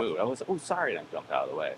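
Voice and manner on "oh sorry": high, light retold apology; high-pitched imitation of apologizing; mock apologetic. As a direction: pitch up for an acted oops-sorry. Retold apology while jumping aside.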